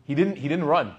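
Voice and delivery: usual speaking voice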